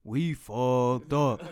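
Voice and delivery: slow, drawn-out groan of bad news; mock sheepish friend on the phone; slowed, sorry-sounding confession; retold phone call. Sean calling after wreck.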